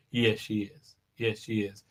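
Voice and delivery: low, very soft assent; mock hushed agreement. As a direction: murmur agreement like an awed onlooker, played up. Crowd agreeing in acted praise.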